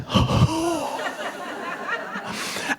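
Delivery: high, breathy gasp with a laugh; startled gasp; big gasp. Retold or imitated. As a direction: gasp like you've just seen your idol. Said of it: "and I was like".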